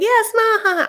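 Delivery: mocking the mom in a high put-on voice; imitating the mom. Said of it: "She's like".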